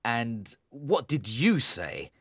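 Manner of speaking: disgusted. A narrator's reading. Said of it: "with an expression of disgust".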